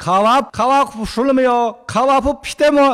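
卡瓦普 said as Uyghur kawap, loud and high; Uyghur phrase demo. Shown as a demonstration. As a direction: Xinjiang Uyghur words, called out. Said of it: "这是维族话".

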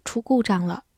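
usual speaking voice

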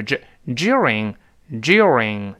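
slow during with a z-like joo, British style. Shown as a demonstration. Teacher repeating the British during slowly. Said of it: "这是z".

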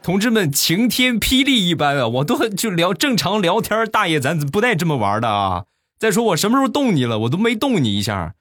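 high-pitched, indignant pleading; flustered protest. Acted. Accused passer-by pleading with old man, flustered protest. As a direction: protest your innocence in a high, hammed-up voice.

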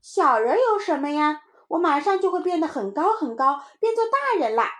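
put-on high, loud boasting kid; defiant little child. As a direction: brag back in a child's voice. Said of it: "松松不服气". (小孩不服气地高声夸口)

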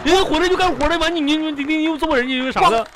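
high-pitched crying complaint; whiny, wronged sobbing protest; tearful wail. Acted. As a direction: blubber out the grievance. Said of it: "你又哭".

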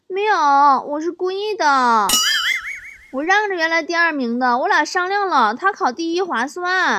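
put-on high-pitched child explaining himself; young son. Son excusing his second place to his father, high child voice. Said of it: "儿子说".

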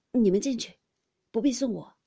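loud, firm parting words; calling out. A narrator's reading. Departing guest telling the others not to see him off, calling out. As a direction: call it back firmly on leaving.

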